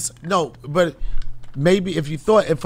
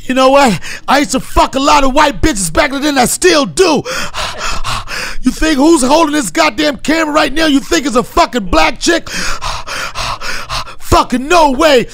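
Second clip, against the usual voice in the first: puffing runner's boastful rant, raised and forceful; heavy breaths between emphatic bursts; hammed-up intensity, swearing on the move; David Goggins impression; 2 semitones higher than usual. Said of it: "incredible David Goggins".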